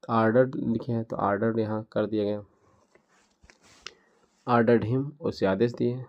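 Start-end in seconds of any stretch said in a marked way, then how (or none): none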